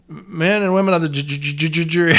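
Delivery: imitating the stuttering lawyer, ju-ju-ju on jury; mock stutter. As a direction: stammer hard on ju- before getting jury out. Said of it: "he has that stuttering problem".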